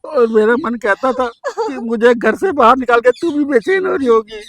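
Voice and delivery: usual speaking voice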